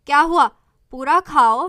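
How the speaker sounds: loud, firm father voice; stern father. Acted. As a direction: order her to finish eating. Father orders daughter to eat. (पिता की ऊँची सख्त आवाज़)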